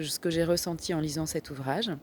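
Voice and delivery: ordinary delivery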